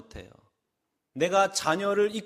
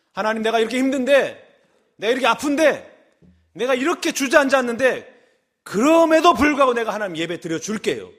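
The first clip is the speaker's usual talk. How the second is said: self-pitying worshipper; 4 semitones higher, 5 dB louder than usual. Acted. Self-pitying worshipper voiced by preacher.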